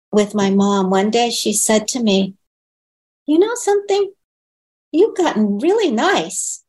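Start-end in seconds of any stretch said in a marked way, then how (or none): 3.3-6.6 much higher, fond imitation of her mother; mother teasing her daughter with praise, imitated high; imitating mother. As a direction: play her mom pleasantly surprised. Mother praising daughter mid-trip.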